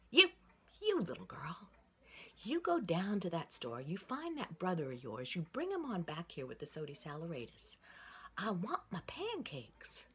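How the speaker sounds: put-on loud scolding old lady; a fuming old woman barking orders. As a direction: order the girl off in a huff. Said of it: "and she was fuming".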